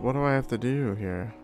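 ordinary talk

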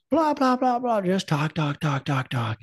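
lightly voiced high chatter of a little boy, fading away; son chattering. Dad imitating his son chattering after waking, a touch high. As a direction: mimic a little kid babbling on downstairs. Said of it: "I can hear him just".

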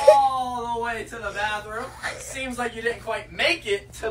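loud exasperated shout at the pet. Real, not acted. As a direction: yell it out in disbelief. Scolding pet over mess.

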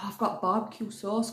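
usual speaking voice

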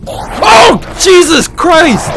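high, loud screams of shock; terrified shouting. Real, not acted. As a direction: shriek in horror as it bites. Monster bites off fingers.

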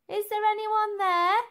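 put-on high, loud girl's voice calling into the dark; Elsie, nervous girl. A narrator's reading. Elsie searches the dark tower, calling out high and loud. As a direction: ask the empty room, hoping someone answers. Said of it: "asked Elsie".

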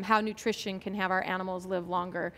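normal talking voice

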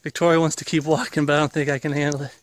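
ordinary delivery

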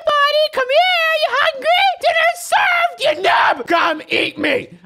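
very high, loud squeaky cartoon taunt; taunting bait voice. Bait player luring the giant worm, squeaky high taunting. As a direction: lure the worm like cheeky bait.

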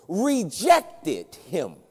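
"rejected" drawn out very slowly with weight; stress on "rejected". Shown as a demonstration. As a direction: lean hard on "rejected", stretching it. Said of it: "emphasize this word".

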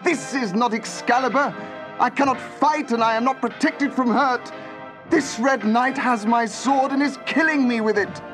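high, loud desperate cry; sustained cry of alarm. A narrator's reading. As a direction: cry out in the thick of a losing fight. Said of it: "cried Arthur".

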